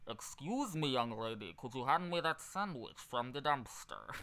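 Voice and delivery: cheeks pulled in, wet alien voice; whiny, sloppy crustacean doctor; Zoidberg voice. Pretend. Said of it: "taught the guy how to do Zoidberg".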